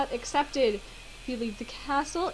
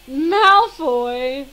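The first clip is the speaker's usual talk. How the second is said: loud, drawn-out cry of a rival's name; hammed-up accusing shout; acted shout; 2 semitones higher, 12 dB louder than usual. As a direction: call out the name in mock alarm. Harry calls out on seeing Malfoy.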